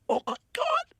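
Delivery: squeaky retold shock; awestruck. Camera guy seeing the glowing disc, stunned and high. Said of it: "the camera guy goes".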